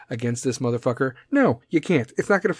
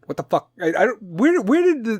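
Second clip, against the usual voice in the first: ordinary talk, nothing marked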